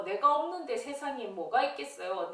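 usual speaking voice

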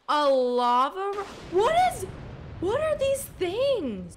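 high, loud, slow baffled yell; startled shout. Real, not acted. Streamer baffled by lava eggs in the yard, startled shout. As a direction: cry out at the weird lava eggs.

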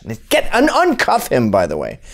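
ordinary talk

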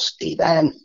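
imitating a high yell across the house; retold holler. Lady on the phone calling Stephen over, high holler. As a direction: call the name out high and long, like summoning someone from another room. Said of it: "hollers at the top of her lungs".

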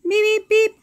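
high, loud beeps; horn beeps. A narrator's reading. Reading about the street, high loud taxi beeps.

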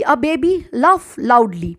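drawn-out example sentence; slow teacher dictation. Shown as a demonstration. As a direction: say it slowly, word by word. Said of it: "dictating an example sentence".

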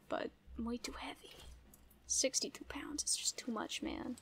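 soft whispered aside. Real, not acted. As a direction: mutter it under your breath. Quiet aside about loot.

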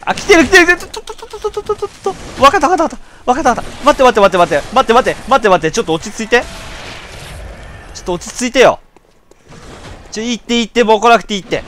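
stammering in a genuine panic; real panic. Real, not acted. Player begging the enemies to back off, panicked and shrill. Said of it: "先ほどは取り乱しました".

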